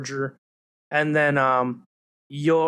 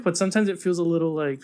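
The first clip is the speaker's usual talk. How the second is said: usual speaking voice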